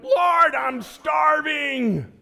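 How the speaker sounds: high, slow, loud mock-anguished plea; desperate prayer. Preacher re-enacting his hungry self pleading with God in church. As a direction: drag it out like a starving man begging God.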